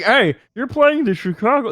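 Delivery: loud, high mock reporter voice; mock announcer. Friend mocking the easy match as a reporter, loud and high. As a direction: ham up a sports reporter's question. Mock sports broadcaster question.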